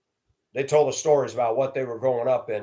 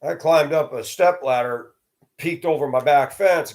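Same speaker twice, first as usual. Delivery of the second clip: normal talking voice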